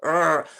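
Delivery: low mock rapper growl; playful throaty "urgh". Shown as a demonstration. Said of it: "doing my D M X impression".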